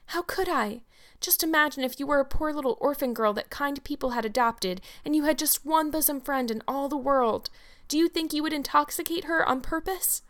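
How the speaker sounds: put-on a young girl pouring out a desperate plea; pleading orphan girl. Anne pleads with Mrs Barry.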